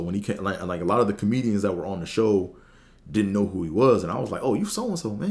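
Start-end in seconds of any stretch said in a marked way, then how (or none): 4.4-5.3 much higher, thrilled fan greeting; light up like you spotted your hero; excited recognition. Retold or imitated. Greeting famous comedian excitedly.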